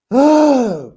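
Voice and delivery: loud, high exhale; exasperated sigh. Real, not acted. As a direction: heave a big exasperated sigh. Exasperated after her rant.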